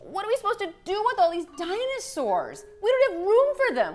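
high, overwhelmed mom protest; exasperated complaint. Acted. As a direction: protest loudly that it's all too much.

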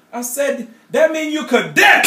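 high, loud retold yell at dad; brother yelling. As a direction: yell it up at your dad from bed. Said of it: "he yells at my dad".

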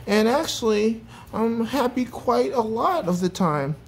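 high-pitched, sincere character voice; earnest Mister Packrat, realizing. A narrator's reading.

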